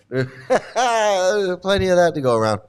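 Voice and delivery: amused repeat dissolving in laughter; laughing. Real, not acted.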